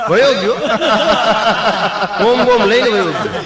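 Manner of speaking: high, loud, laughing shout; comic punch. Real, not acted. Comedian shouting that the agent ran off, high and laughing. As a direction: belt the punchline at the audience.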